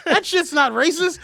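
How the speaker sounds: loud, slow, high denial; indignant protest. Retold or imitated. Retold movie character protesting innocence.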